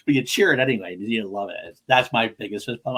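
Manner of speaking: usual speaking voice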